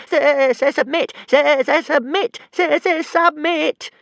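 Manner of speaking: very high, loud stuttering shriek. A narrator's reading. Tiny dragon shrieking at Hiccup to give up. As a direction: shriek it at the top of his voice.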